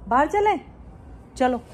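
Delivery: high, loud question to a pet; calling the pet. Real, not acted. Asking the pet about going outside, high loud call. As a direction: ask it loudly, voice lifted high.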